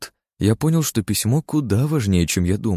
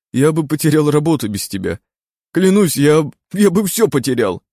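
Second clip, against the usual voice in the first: higher, louder, halting voice choking up with tears; tearful brother; 6 semitones higher, 5 dB louder than usual. Acted. Brother thanking Aleksey through tears, choked and halting. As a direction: stammer through the thanks as if about to cry. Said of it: "шмыгнул носом Ярослав".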